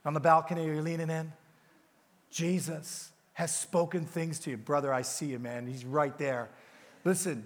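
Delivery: normal talking voice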